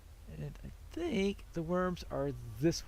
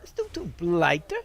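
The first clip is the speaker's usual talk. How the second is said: "A Stone Troll Blighter?" in a weak, louder Cockney accent with a questioning rise; bad Cockney accent; 5 dB louder than usual. Shown as a demonstration. Gamer reading the enemy name in a bad Cockney accent. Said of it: "Cockney accent for some reason".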